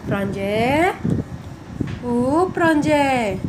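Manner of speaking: slow drawn-out calling; sing-song calling. Acted. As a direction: call his name slow and sing-song. Uncle seeking child in hide-and-seek.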